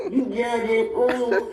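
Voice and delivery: high, sing-song mock commenter. Host mocking defensive commenters with a taunting voice. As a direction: taunt in a put-on voice. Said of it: "Y'all gonna say".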